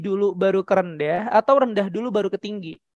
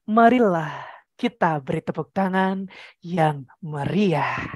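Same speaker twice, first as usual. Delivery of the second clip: dragging, sinking MC announcement; slow, limp call for applause; too-slow applause call; 4 semitones lower than usual. Shown as a demonstration. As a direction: stretch it out lifelessly, dropping at the end. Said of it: "Gak ada yang tepuk tangan kalau kayak gitu".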